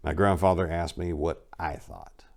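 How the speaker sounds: ordinary delivery, nothing marked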